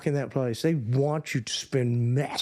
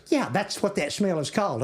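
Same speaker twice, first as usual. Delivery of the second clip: put-on higher Texan little-girl voice; little Texas accent; 7 semitones higher than usual. Little sister answering Yankee girls, high Texas accent. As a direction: imitate a sure-of-herself Texan kid sister. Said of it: "with a little Texas accent".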